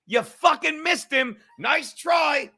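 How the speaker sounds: high, very loud taunting shout; screaming. Retold or imitated. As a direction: scream it out in triumphant defiance. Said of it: "And I started screaming".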